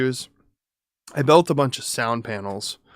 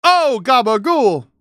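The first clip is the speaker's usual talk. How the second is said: loud, high hammed-up New York Italian; big drawn-out New York accent; 11 semitones higher, 8 dB louder, slower than usual. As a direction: holler it like a cartoon New Yorker. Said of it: "I was doing my New York accent".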